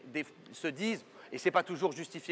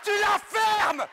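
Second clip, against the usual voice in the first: high, loud exasperated yelling; host screaming at guest to shut up; furious shout; 7 dB louder than usual. Acted. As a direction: blow up at him, screaming. Host loses temper, screams.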